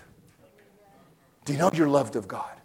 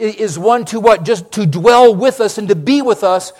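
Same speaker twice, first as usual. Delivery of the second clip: rising, louder preacher's build; preaching at full force; 5 semitones higher, 8 dB louder than usual. Acted. Preacher rouses the congregation, loud and high. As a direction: drive the point home to the church.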